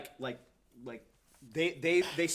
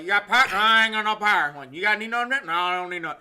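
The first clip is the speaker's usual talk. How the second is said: louder, higher slurred Southern twang; drunk Boomhauer Southern accent; 5 semitones higher, 10 dB louder, faster than usual. Retold or imitated. Back-and-forth with holler folks in a loud Southern accent. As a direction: rattle it off in thick holler Southern. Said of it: "you're talking like that".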